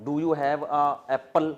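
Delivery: slow, over-careful village-style English; mock a villager's deliberate English; rustic Pakistani English. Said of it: "پینڈو لوگ جب English بولتے ہیں".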